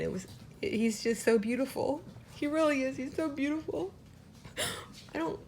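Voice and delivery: high, choked-up voice on the edge of tears; getting emotional. Real, not acted. Breeder gushing over her surviving puppy, choking up. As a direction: voice lifting and cracking as tears come. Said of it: "oh gosh, I'm getting emotional".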